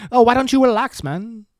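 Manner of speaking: put-on half-hearted go at a Spanish accent; attempted Spanish accent. Host mocking disdainful Spaniards, attempted Spanish accent. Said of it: "can I do a Spanish accent?".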